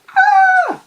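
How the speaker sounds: shrill, very high panicked scream, loud; high pitched scream. Retold or imitated. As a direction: scream high like you've just been ambushed. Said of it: "I screamed, / My voice went high pitched".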